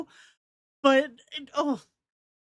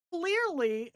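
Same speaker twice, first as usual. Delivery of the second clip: ordinary talk, nothing marked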